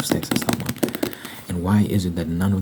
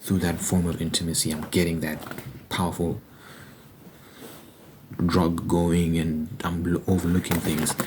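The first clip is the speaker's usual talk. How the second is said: usual speaking voice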